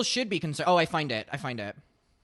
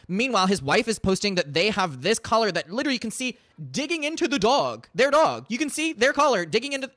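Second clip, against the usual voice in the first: getting louder and higher with outrage; rising indignant rant; 6 semitones higher, 5 dB louder than usual. Real, not acted. Ranting to co-hosts about the wife's collar photo, building loud. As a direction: build from heated to near shouting.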